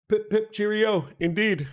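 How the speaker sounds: high, hammed-up posh British accent; jokey upper-class British voice; mock British accent. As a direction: play a silly British toff saying cheerio. Mock English toff phrase.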